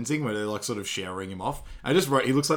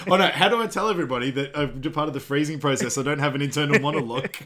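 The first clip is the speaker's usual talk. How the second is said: laughing; 4 semitones higher, 6 dB louder, faster than usual. Retold or imitated. Mock Austin aside, laughing.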